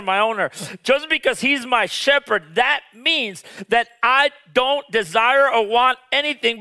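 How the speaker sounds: preaching high and insistent; fervent preaching. Acted. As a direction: press the point home to the crowd. Preaching climax, raised pitch.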